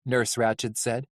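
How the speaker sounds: plain reading voice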